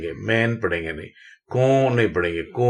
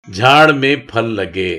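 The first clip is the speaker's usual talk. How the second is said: झाड़ में फल लगे read slowly and louder; slow louder reading aloud; 6 dB louder, slower than usual. Shown as a demonstration. Teacher reading a practice sentence to the class slowly. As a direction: sound out each word for the learners. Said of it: "reading practice sentence".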